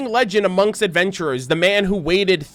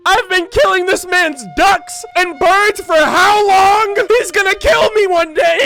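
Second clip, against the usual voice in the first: panicked shout; 12 semitones higher, 5 dB louder than usual. Real, not acted. Panicked realization about Timmy.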